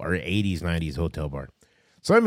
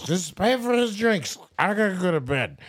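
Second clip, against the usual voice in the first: lightly voiced barfly bowing out; drunk stranger; 12 semitones higher than usual. Storyteller imitates the drunk man telling the bartender to pay, sleepy. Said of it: "he goes".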